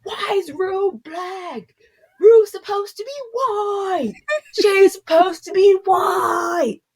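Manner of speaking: high, drawn-out mock wail of outrage; mock screaming. Imitating outraged Hunger Games fans on their soapbox, mock screaming. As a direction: imitate a whiny online meltdown, stretching every word. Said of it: "they were all screaming and yelling like".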